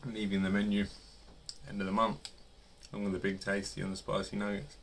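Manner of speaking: speaking with food in mouth; talking while chewing. Real, not acted. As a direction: between bites of food. Seen 1: Bitten nugget held at lips, recent bites, mouth working while speaking.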